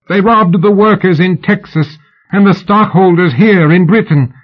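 high, forceful theatrical indictment; high-pitched, impassioned public protest; revitalized accusation. A narrator's reading. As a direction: denounce the thieves with fresh vigor. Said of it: "put a jolt of vitality into her".

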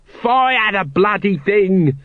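high drawn-out mock scream. A narrator's reading. Nobby urging Colon to shoot, screaming. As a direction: scream the order, high and stretched. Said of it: "screamed Nobby".

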